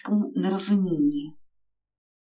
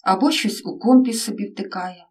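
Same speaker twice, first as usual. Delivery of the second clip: ordinary delivery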